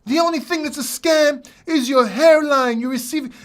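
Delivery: high, loud taunting voice; mocking commenter. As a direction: sneer it like a heckler. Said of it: "most of the comments are".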